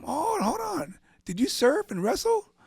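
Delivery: high, loud, suddenly eager; the doctor can't believe who's in front of him; excited recognition. Retold or imitated.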